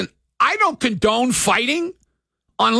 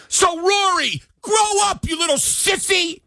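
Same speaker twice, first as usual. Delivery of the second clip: high, mocking scold at the golfer; taunting scold; 10 semitones higher than usual. Real, not acted. Host taunting the golfer, mocking.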